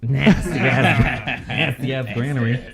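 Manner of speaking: laughing through nasty ass; giggling while saying the name. Real, not acted. Mocking the NPC's name.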